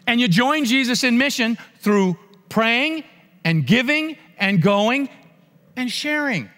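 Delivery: fervent preacher hammering the call to mission; preaching. Acted. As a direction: drive each point home slowly from the pulpit. Preaching at full force.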